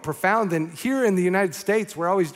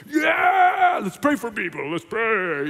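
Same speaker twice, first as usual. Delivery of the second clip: mock pumped-up; 3 semitones higher, slower than usual. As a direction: act out overexcited faith with a big "yeah". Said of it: "my faith got all pumped up".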